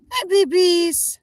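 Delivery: high, slow cooing greeting. Real, not acted. Owner greeting her geese: high slow baby talk. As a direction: coo at the goslings like babies.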